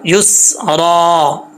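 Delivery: युसरा slowly, ending in long aa; pausal alif. Shown as a demonstration. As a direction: hold "स", then stretch the last vowel of युसरा. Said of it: "ये जो है अलिफ पढ़ा जाएगा". (युसरा के आख़िर में लंबा अलिफ)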